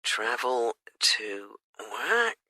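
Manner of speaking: soft slow mutter while typing; muttered note to self. Real, not acted. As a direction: murmur it slowly as you type it in. Agent repeats answer while typing.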